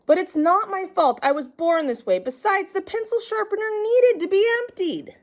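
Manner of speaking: put-on a kid insisting it's not his fault; whiny child protest. A narrator's reading. Noodle whining back at his teacher, high and pleading.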